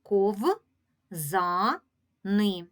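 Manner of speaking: Ков, за, ни as distinct beats with short pauses; counting out the syllables Ков, за, ни; split into syllables. Shown as a demonstration. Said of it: "в цьому слові три склади".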